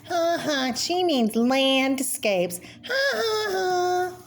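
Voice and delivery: high, slow, teasing cartoon voice with laughter; mocking cartoon laugh. Cartoon kid mocking Grandma Moses, high teasing laugh. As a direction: cackle and tease like a cartoon bully.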